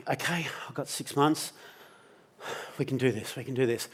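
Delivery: soft, steadying self-talk; quiet self-reassurance. Retold or imitated. Man counting six months left with his mate, quietly steadying. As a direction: talk yourself into it, softly. Talks himself through the bad news.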